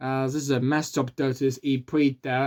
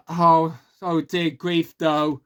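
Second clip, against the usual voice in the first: normal talking voice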